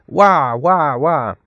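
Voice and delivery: put-on storybook baby crying noise; imitated baby wail. A narrator's reading. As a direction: read out a baby's wail, drawn out. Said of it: "but still she cried".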